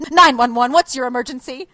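projected mock emergency-call greeting; emergency operator. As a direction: imitate a dispatcher suddenly blaring out. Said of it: "and suddenly I hear".

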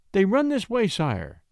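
high, ardent opening, dropping on sire; fervent but deferential; respectful ardor. A narrator's reading. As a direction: offer it eagerly to a king. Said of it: "with respectful ardor".

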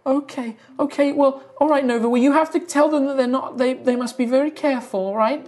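high, earnest cautioning voice; high-pitched, fretful figure; timid tree woman. Acted. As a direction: warn in a high, anxious creature voice.

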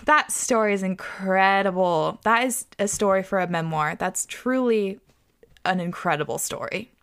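normal talking voice

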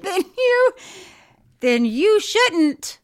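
loud, high, drawn-out mock reprimand; hammed-up scolding. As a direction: play up the telling-off, slow and emphatic. Hammed-up repeat of own scolding.

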